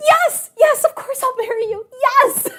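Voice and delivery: very high, squealed acting; overjoyed acceptance. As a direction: imitate a thrilled bride-to-be gushing. Acting her sudden acceptance of proposal.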